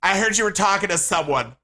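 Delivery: loud, high mock accusation; combative girlfriend. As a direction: pick a fight as the jealous girl. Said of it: "loved fighting, just gets in fights".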